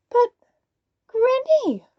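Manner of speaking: plain reading voice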